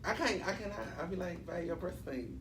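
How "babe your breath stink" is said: very soft, sheepish delivery with a laugh; quiet, reluctant confession to a partner; hoping not heard. Acted. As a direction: slip it out under your breath, half laughing. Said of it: "just hope you don't hear me".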